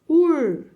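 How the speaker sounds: UR loud with a retroflex R; caipira R. Shown as a demonstration. Teacher reading U plus R, caipira R in "ur". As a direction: punch out "ur" on a curled-tongue R. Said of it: "vai fazer o som caipira".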